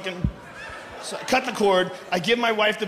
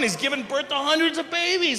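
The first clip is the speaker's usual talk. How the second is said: normal talking voice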